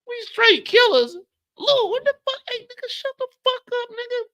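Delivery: high-pitched angry yelling from the doorway. Acted. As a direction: yell at the noise outside, high and loud. Neighbor yelling out the door.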